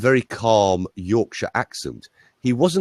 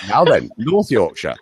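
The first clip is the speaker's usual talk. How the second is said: a light North Yorkshire accent with a laugh; laughing through a North Yorkshire greeting; 3 dB louder than usual. Shown as a demonstration. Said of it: "I can do a very good Yorkshire accent".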